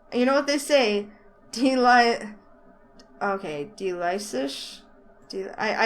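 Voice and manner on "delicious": "delicious?" drawn out slowly, ending on a questioning rise; puzzled pronunciation try. Real, not acted. Reader unsure how to say the brand, slow questioning. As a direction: sound out "delicious?" slowly, unsure, rising at the end. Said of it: "supposed to be a pun on delicious".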